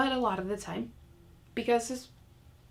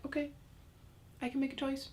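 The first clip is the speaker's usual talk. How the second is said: put-on calmed-down child; 6 semitones higher, 8 dB quieter, faster than usual. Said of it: "brain state where they're like".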